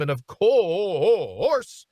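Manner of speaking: slow, swooping, hammed-up delivery; playful drawn-out flourish. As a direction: stretch it out with a wobbly wail. Playful drawn-out station plug.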